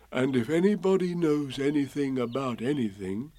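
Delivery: lightly voiced musing bear; Pooh, to himself. A narrator's reading. Pooh reasoning to himself, musing. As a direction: think it through aloud. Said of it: "said Bear to himself".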